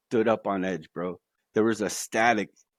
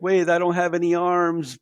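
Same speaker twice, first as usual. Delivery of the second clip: mock alarm; 7 semitones higher, 4 dB louder than usual. Ball flying towards him with no arms, mock alarm. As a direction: act out helpless panic, pitched well up.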